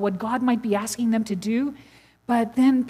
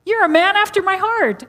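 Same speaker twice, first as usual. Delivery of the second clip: higher and louder proclamation; God's approving voice, raised; God speaking; 8 semitones higher, 6 dB louder than usual. Retold or imitated. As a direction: announce it with wonder and force.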